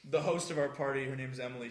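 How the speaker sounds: normal talking voice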